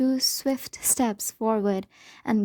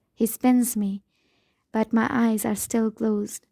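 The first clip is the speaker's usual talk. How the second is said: ordinary delivery, nothing marked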